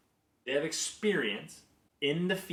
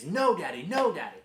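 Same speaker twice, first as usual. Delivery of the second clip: higher, louder imitated toddler refusal; feisty; 6 semitones higher, 5 dB louder than usual. Daughter kept refusing Daddy, imitated feisty protest. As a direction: imitate a feisty, upset small child. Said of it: "All feisty".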